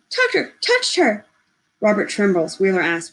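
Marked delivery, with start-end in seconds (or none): 0.1-1.2 high squeaky rapid panic; squeaking. Acted. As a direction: squeak it out fast in alarm. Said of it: "He speaks rapidly, squeaking".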